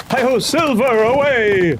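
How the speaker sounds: hero's cry to his horse; rallying cry. Acted. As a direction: spur the horse on with a ringing shout. Hero spurs horse on.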